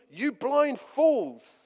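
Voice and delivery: loud, slow, hammered insult; forceful rebuke. Retold or imitated. Jesus calling the Pharisees blind fools, slow and loud. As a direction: spit out every word slowly. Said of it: "these aren't soft words".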